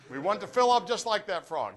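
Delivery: normal talking voice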